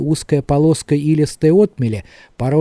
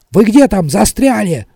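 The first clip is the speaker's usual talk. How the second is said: higher, louder, strained question; tense; 6 semitones higher, 5 dB louder than usual. A narrator's reading. Tally calling to companions from the dark, tense. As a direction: demand it tensely out of the darkness. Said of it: "напряжённым голосом спросил из темноты Талли".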